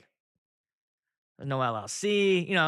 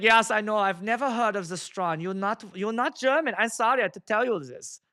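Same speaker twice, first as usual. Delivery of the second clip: mock German accent, pitched up; imitating an apologetic German accent; 5 semitones higher than usual. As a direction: insist in a put-on German accent. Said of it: "he's got the German accent".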